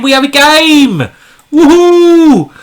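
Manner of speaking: high, loud whoop of delight; excited cheer. Real, not acted. Streamer cheering as an opponent accepts, excited whoop.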